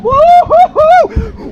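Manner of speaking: high, long, loud woo-hoo-hoo; very high, drawn-out victory whoop; whooping cheer. Real, not acted. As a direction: whoop like you just hit the jackpot. Celebrating two bucks caught.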